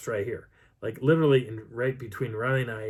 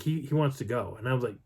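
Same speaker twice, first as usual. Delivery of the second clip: usual speaking voice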